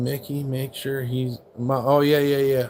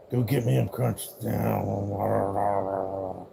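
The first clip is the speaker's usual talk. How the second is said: slow low dog voice; 5 semitones lower, 4 dB quieter, much slower than usual. Pretend. Voicing dog's demand.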